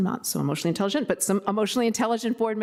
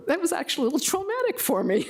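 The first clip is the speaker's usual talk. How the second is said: higher, laughing through the words; 10 semitones higher, faster than usual. Real, not acted. Board member laughing as she recalls the nervous night. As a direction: laugh it off while telling it.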